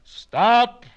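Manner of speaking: high, loud howled stop. Acted. Plansky howling at Kaplan's logic. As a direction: howl in protest. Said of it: "howled Mister Plansky".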